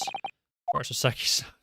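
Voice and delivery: breathless whisper; whispered aside. Acted. Detective murmuring an aside after laughing, whispered aside. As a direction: whisper it to yourself.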